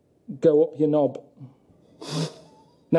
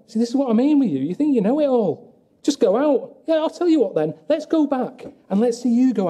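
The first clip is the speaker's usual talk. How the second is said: high-pitched, indignant retort; exasperated; 8 semitones higher than usual. Real, not acted. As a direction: argue back, pitch climbing with irritation. Arguing back about going outside.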